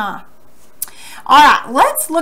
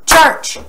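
"Church" as one firm, higher beat; one-clap syllable; 4 semitones higher, 4 dB louder than usual. Shown as a demonstration. Teacher clapping along with pupils, "Church" as one firm beat. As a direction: punch "Church" on a single clap. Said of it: "Clap with me".

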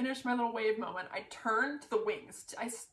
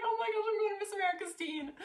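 excited squeal; 11 semitones higher, faster than usual. Retold or imitated. Realising she goes to nationals.

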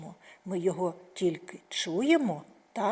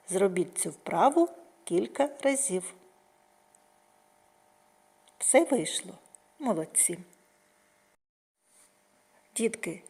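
ordinary talk, nothing marked

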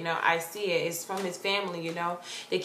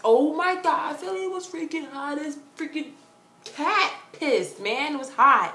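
high, drawn-out heat complaint; emphatic complaint; 7 semitones higher than usual. Real, not acted. Vlogger recalling freaking hot Philly, emphatic complaint. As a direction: exclaim about the heat, pitched up.

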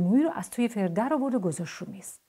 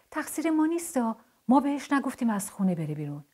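lightly voiced, higher-pitched toy protesting; toy character's protest; 6 semitones higher than usual. A narrator's reading. Toy Dogoosh defends himself to Parviz, higher-pitched protest.

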